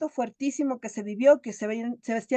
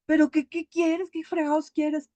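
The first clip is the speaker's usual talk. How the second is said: higher, acted exasperation; exasperated mother; 5 semitones higher than usual. Mother fed up with her son interrupting, exasperated. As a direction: snap back at a pestering child. Madre harta regaña al hijo.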